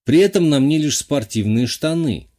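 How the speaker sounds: ordinary delivery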